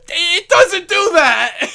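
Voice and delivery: high, slow words through laughter; laughing disbelief. Real, not acted. Creator laughing that the game really says that, high and slow.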